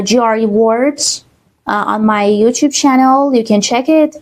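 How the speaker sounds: usual speaking voice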